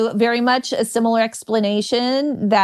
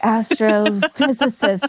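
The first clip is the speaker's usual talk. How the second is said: starlet voice at a job interview; Marilyn Monroe. Pretend. Comedian mocking a job interview done in starlet voice. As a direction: play the breathy screen siren applying for work. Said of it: "going to a job interview and being like".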